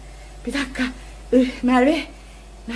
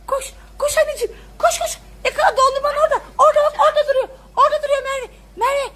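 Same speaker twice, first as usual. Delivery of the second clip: high, fast, laughing urging; excited cheering; 8 semitones higher, much faster than usual. Real, not acted. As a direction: cheer her toward the ice cream. Cheering daughter during chase. (gülerek heyecanla teşvik)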